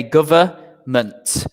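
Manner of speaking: government said slowly as guv-er-ment, no N; slow pronunciation model. Shown as a demonstration. Teacher to online class, modelling government slowly with no N. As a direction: drag out government and leave out the N. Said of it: "As you can see here".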